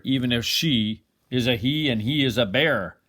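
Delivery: usual speaking voice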